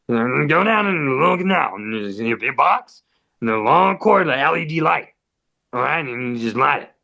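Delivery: hammed-up mumbling impression of an oddball older guy; neighbor's weird voice. Neighbor instructing guests at his door, imitated weird voice. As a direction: slur the instructions together, imitating his odd delivery. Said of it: "how he sounds and how he says it".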